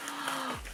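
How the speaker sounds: soft, quick gasp of surprise. Real, not acted. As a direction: catch your breath at the reveal. Unboxing, glimpse of the contents.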